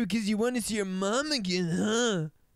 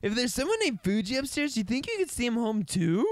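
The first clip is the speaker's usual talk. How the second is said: usual speaking voice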